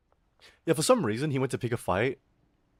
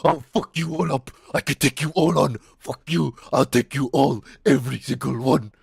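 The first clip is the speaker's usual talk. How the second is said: raised, louder put-on voice of a raging classmate; mock screaming; 3 semitones higher, 5 dB louder than usual. Punched classmate challenging everyone, imitated screaming. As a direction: imitate a punched boy's defiant yelling. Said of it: "He started screaming, going".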